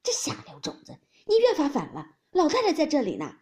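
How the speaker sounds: high rebuke growing loud; angry scolding. A narrator's reading. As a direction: scold the unruly young man, raising your voice. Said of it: "气得忙拦住，骂道". (气得高声责骂)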